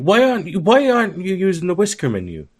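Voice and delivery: high-pitched mimicry of a pestering user; mock nagging user. Mimicking users pestering about Brisk Menu, high mock nagging.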